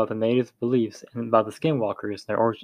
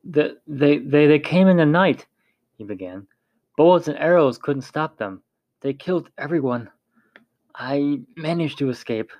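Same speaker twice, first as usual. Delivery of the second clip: a frightened trader trembling through his tale; 4 semitones higher than usual. A narrator's reading. Frightened trader telling the tribe, trembling voice. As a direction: voice shaking, stuttering in fear. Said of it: "his voice trembled".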